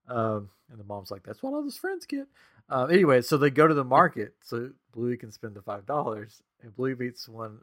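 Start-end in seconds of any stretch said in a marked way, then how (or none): 1.2-2.3 very high, softer mom voice; cartoon mom. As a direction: lift it high for a mock mother's reply. Voicing cartoon mom in retelling.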